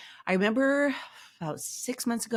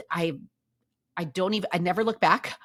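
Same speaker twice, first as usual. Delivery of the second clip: usual speaking voice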